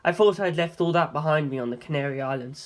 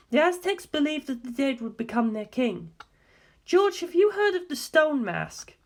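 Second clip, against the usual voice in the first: a hint of a grave woman asking; earnest, probing question, lightly voiced; a faint woman's voice, asking seriously; lightly voiced Darlington; 8 semitones higher than usual. A narrator's reading.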